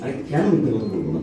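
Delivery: ordinary talk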